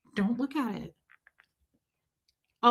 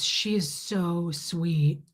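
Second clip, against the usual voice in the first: slow, soft lower coo; 5 semitones lower, 5 dB quieter, much slower than usual. Retold or imitated. The nurse gushing about the puppy, slow coo. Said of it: "And she goes".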